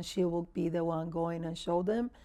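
usual speaking voice

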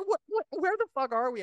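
high-pitched, stammered bewilderment; hammed-up incredulous question; mock disbelief. Acted disbelief at the scene.

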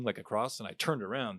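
ordinary talk

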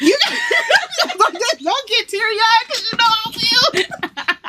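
laughing. Real, not acted. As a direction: giggle through the warning, already welling up. Warns friend not to cry.

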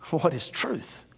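soft, hushed and inward; quiet musing question. Acted. Pilate to Jesus, a soft hushed question. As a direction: ask it half to yourself.

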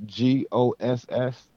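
G O S S spelled out letter by letter; spelling it out. Shown as a demonstration. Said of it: "my last name is [name]".

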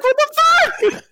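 high, slow, stretched-out what the fuck; incredulous. Retold or imitated. Retelling being called Cuban, a high slow squeal of disbelief. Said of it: "And I was like".

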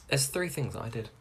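ordinary talk, nothing marked

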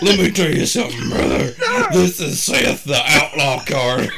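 high, loud hammed-up wrestler promo; wrestling promo voice. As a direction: cut a promo like a pro wrestler. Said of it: "sounds like you're cutting a wrestling promo".

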